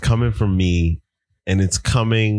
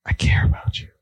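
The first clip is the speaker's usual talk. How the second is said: sultry whisper; faster than usual. Acted. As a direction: whisper it seductively. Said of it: "I like to put my sultry voice on".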